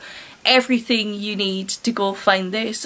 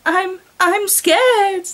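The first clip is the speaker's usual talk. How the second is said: put-on scared girl; 7 semitones higher, much slower than usual. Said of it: "the girl managed to say".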